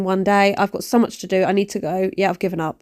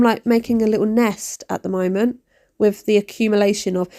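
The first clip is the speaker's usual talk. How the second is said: ordinary delivery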